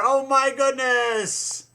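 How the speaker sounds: high, drawn-out astonishment without shouting; amazed exclamation. Acted. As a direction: marvel slowly at the mess. Second reaction to the crash.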